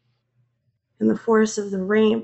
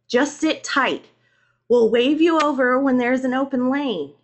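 higher, louder instruction; officer calling out; 5 semitones higher, 6 dB louder than usual. A narrator's reading. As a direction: direct a driver over the storm.

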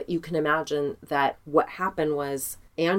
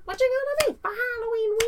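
much higher, cartoonish squeak; squeaky silly voice. Repeats question in silly squeaky voice.

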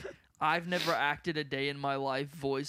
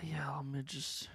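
whisper; 7 dB quieter, much slower than usual. Real, not acted. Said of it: "sigh, then trails into whisper".

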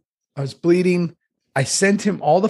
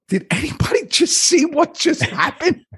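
incredulous high plea; 11 semitones higher than usual. Retold or imitated. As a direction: voice shooting up, stunned and disbelieving. Bloodied, asking kids.

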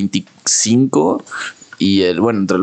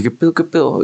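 alarmed exclamation; 8 semitones higher than usual. Retold or imitated. Retelling a house fire.

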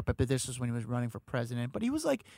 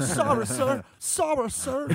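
much higher, meek nervous sorry; timid apology; 11 semitones higher, 5 dB louder, much slower than usual. Retold or imitated. The crew guy apologizing to an angry Trump, high and cowed. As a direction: grovel a little, pretend he got to you. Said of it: "I'm shaking".

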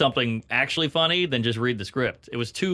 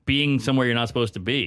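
usual speaking voice

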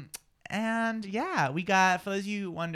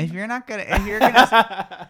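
laughing; 3 semitones higher, 10 dB louder than usual. Real, not acted. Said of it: "laughing mid-sentence".

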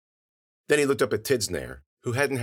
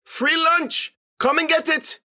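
high, loud calling shout; full-voiced yell to draw them out; taunting holler; 7 dB louder, slower than usual. A narrator's reading. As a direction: holler it like a dinner call across the street. Character hollering to lure monsters.

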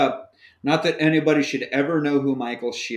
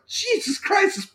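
incredulous; 14 semitones higher, slower than usual. Retold or imitated. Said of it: "And I was like".

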